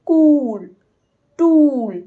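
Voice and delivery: teacher modelling the long oo; long oo demo. Shown as a demonstration. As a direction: stretch the oo in কুল and টুল. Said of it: "অনেকক্ষণ ধরে উটা উচ্চারণ হয়".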